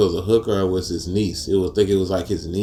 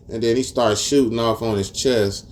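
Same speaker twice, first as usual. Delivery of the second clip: ordinary talk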